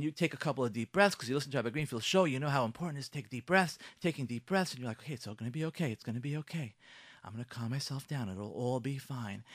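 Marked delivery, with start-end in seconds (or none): 5.0-9.4 self-soothing calm. Pretend. Wife breathing deep, calming herself down softly. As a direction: talk yourself down after deep breaths.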